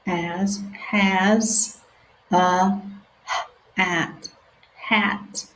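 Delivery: stretched-out sounding out; slow sounding out. Shown as a demonstration. Teacher reading slowly with children. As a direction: read each word slowly for beginners.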